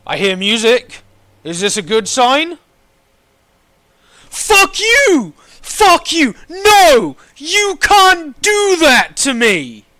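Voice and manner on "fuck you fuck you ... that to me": loud, high outraged yelling; angry shouting. Real, not acted. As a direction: shout in real outrage at the game. Kicked out of game queue, outraged.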